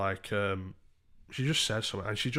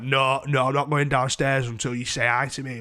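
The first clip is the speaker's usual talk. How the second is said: loud mock refusal, slightly raised pitch; stubborn drunk woman; 3 semitones higher, 6 dB louder than usual. Woman refusing to go downstairs, stubborn. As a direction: imitate her digging her heels in.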